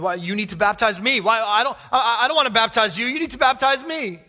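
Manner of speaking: high, loud, flustered protest; John the Baptist. Retold or imitated. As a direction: balk at the request, voice climbing.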